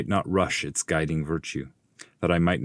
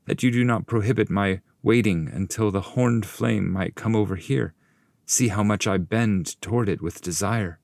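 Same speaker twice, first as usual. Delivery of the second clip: higher, fervent pleading voice; Dante the pilgrim pleading; 5 semitones higher than usual. Acted. Pupil pleading to wait for the horned flame, fervent raised voice. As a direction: beg your master with longing.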